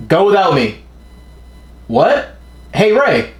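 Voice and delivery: high-pitched, urgent-sounding call. Acted. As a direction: act out calling after someone, pitched well up. Acting out manga characters calling out.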